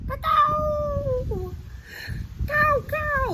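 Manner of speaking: high, loud crow cawing. Shown as a demonstration. Teacher showing kids how crows sound, high loud caw. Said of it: "it sounds like this".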